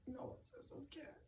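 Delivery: whispered under the breath. Real, not acted.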